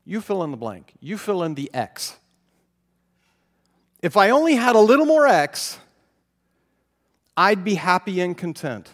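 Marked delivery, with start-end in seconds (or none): 4.0-8.9 whiny wishing. Pretend. Mock whiny wish of discontent.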